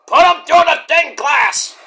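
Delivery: hammed-up wrestler's shout; shouted order. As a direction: yell it like a ringleader ordering henchmen. Acting Moxley's barked order.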